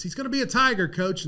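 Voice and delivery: high, proud mock announcement; excited report. Assistant telling head coach the recruit signed, high and proud. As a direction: announce the win to the boss. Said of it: "Randy says".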